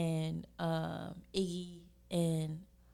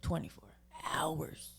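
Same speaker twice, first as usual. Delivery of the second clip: whispered. Real, not acted. Hushed praise of all-night food.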